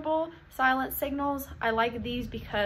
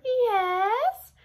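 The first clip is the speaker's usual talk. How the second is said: much higher, louder "Yes" drawn out; high silly voice; 11 semitones higher, 4 dB louder than usual. Shown as a demonstration. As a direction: answer in a squeaky cartoon voice. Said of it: "different accents or different voices".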